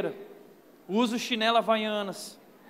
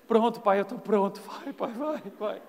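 put-on 1 resigned child bracing. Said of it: "chegava lá no quarto".

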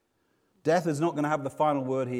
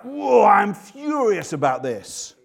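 slow, louder furious cry; a preacher voicing Jesus' anger; bellowing anger; 6 semitones higher, 8 dB louder, slower than usual. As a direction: let the rage burst out of him. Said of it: "bellowed out in anger".

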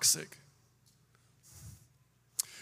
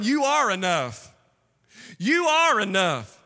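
emphatic preaching; 7 semitones higher, 4 dB louder than usual. Acted. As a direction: proclaim it to the congregation, stretching key words. Preaches at full force.

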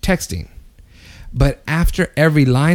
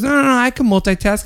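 higher, dismissive protest; mock defensive friend; 7 semitones higher than usual. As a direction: brush it off like a know-it-all. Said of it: "He goes".

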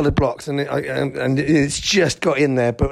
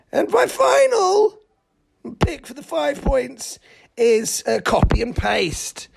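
high silly voice; 11 semitones higher than usual. Acted. Sudden high put-on voice.

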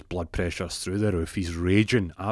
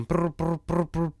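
high trilled brrs by mouth; rolled, high-pitched ringing noise; imitated phone ringing; 9 semitones higher, slower than usual.

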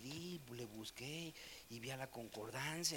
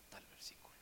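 breathy whisper, no voice; 12 dB quieter than usual. Real, not acted. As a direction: drop to a hush on the last words. Ends a quote in a whisper.

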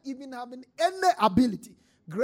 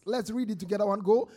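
ordinary delivery

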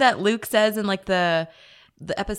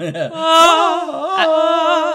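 high, loud drawn-out "oh"; hammed-up catchphrase howl; Ace Ventura; 7 semitones higher, 9 dB louder than usual. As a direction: stretch the cry out comically. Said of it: "but it is Ace Ventura".

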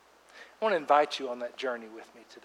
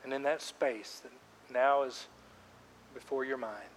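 guided prayer; 10 dB quieter than usual. Acted. Pastor guiding congregation in silent prayer, softly. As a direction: lead them gently into quiet prayer. Leads a quiet prayer time.

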